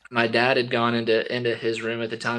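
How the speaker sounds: ordinary talk, nothing marked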